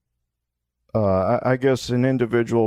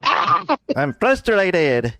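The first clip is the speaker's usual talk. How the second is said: mock drawn-out echo; 7 semitones higher, much slower than usual. Mock echo of her word.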